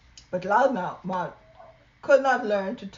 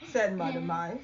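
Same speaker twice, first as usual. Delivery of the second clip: usual speaking voice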